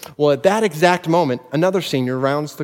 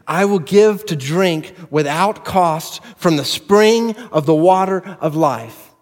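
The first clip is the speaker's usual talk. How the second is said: slow, higher and louder, building; preaching at full force; 7 semitones higher, 6 dB louder than usual. Acted. Preacher voicing God to his people, climactic and fervent. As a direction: proclaim God's promise with rising fervor.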